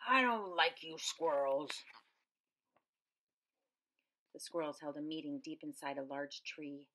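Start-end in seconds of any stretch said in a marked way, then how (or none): 0.1-1.8 put-on slow, gruff old man's voice; grumpy old man. A narrator's reading. Old man shooing squirrels from the window, slow gruff grumble. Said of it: "shouted the old man".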